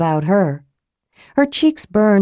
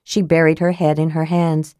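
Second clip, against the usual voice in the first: plain reading voice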